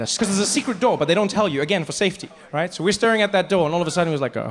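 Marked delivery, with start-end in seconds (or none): none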